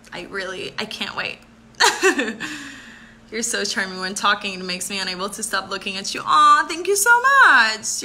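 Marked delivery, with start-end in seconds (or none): 1.8-2.9 happy giggle. Real, not acted. Happy laugh about Thailand. 6.2-8.0 high, loud and slow, gushing thanks; touched. Real, not acted. Thanking a viewer for a compliment, gushing aww.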